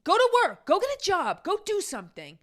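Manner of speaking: loud, high, angry order to get a job; real outburst, loud and high; fired-up shout. Real, not acted. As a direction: yell it at the complainers, fired up. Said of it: "see, now I'm fired up".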